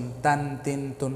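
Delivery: slow, drawn-out तन तिन तुन with a clear nasal n ending; tanween demo. Shown as a demonstration. Quran teacher demonstrating tanween to students, slow and clear. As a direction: sound out तन तिन तुन slowly, each ending on n. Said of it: "ता दो ज़बर तन".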